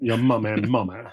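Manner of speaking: Seb. Retold or imitated. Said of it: "How does Seb say it?".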